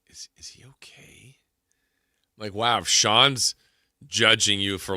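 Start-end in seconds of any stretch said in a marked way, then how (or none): 0.1-1.4 hushed, whispered concern; barely voiced, secretive whisper; whispered aside. Acts out friend's aside mid-movie.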